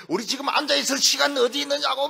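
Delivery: mock hellfire bellow at the flock; shouting preacher. Preacher yelling at the congregation to burn away sin, hammed-up shout. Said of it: "소리를 막 지르면서".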